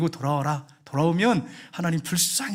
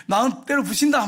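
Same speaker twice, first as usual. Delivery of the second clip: raised, louder retold outburst; angry threat; 7 semitones higher, 3 dB louder than usual. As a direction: act out a furious father's threat. Father threatens to break door. (화나서 윽박지르는 말투)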